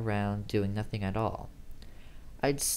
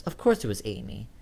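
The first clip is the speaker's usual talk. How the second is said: ordinary delivery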